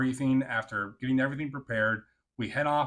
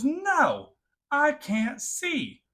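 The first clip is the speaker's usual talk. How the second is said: high, slow whining voice, much louder; whiny refusal; 6 dB louder, much slower than usual. Retold or imitated. Soldier refusing her squadmate's order, high slow whine. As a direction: drag the words out like a sulky kid.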